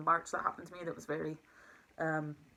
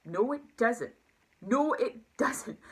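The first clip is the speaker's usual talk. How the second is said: high, loud insistent denial, second one slow; emphatic retort; 7 semitones higher, 4 dB louder, much slower than usual. Retold or imitated. To her manager, insisting loudly and slowly it doesn't. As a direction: flatly contradict him, rising in pitch.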